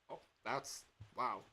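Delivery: normal talking voice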